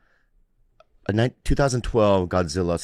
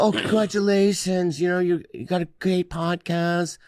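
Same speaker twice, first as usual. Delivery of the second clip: higher, slow, gushing praise; high gushing fan voice; 8 semitones higher than usual. Retold or imitated. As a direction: gush like an impressed fan. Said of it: "People go".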